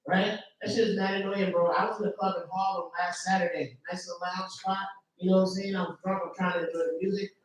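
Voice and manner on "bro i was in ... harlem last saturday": normal talking voice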